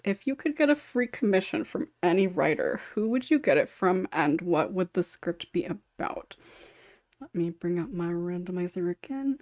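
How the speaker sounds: normal talking voice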